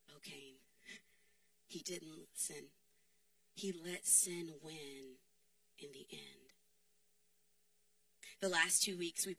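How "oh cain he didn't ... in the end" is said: soft, low, sorrowful; quiet lament. Real, not acted. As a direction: mourn his choice quietly. Lament over Cain.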